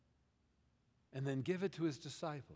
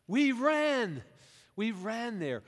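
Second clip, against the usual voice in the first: excited burst; 8 semitones higher, 7 dB louder, slower than usual. Acted. Preacher acting the eager crowd.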